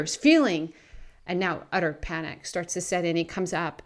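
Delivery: ordinary talk, nothing marked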